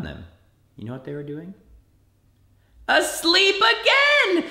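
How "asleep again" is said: much higher, louder "asleep again" cry; exasperated surprise. Real, not acted. Preacher telling kids the disciples slept, loud disbelieving cry. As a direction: throw up your hands in disbelief.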